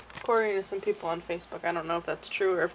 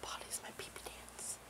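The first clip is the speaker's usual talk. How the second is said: very soft, whispered little aside; playful whisper; 20 dB quieter than usual. Pretend. Wife needing the potty, playful whisper to herself. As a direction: whisper it barely audibly.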